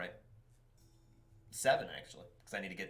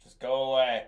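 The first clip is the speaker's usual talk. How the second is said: pleading mutter; 3 semitones higher, 7 dB louder than usual. Real, not acted. Player pleads with dragons.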